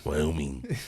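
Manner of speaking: lightly voiced old man, Wyoming rising; a touch of an old man on Wyoming; old man from Squid Games. Shown as a demonstration. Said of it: "like the old man from Squid Games".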